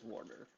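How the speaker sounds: "water" slowed, R in the first syllable; dad's "water". Retold or imitated. Imitating dad's accent, "water" said as "worder". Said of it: "My dad says water".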